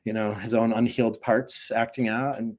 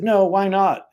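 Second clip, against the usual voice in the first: indignant why not; 10 semitones higher, 7 dB louder, slower than usual. Retold or imitated. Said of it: "He said".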